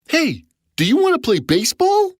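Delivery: high, fast invitation; eager child giant. A narrator's reading. Giant inviting Jack to play, eager. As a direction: ask brightly like a keen kid. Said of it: "said the giant".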